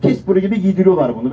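usual speaking voice

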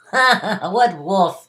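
put-on a teasing boy laughing at them. A narrator's reading. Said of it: "the boy laughed".

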